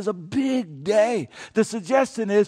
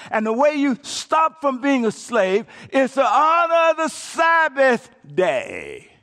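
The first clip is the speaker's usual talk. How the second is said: high, loud shouting sermon; preacher shouting from the pulpit; full-force preaching; 7 semitones higher, 5 dB louder than usual. Acted. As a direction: thunder the command at the congregation.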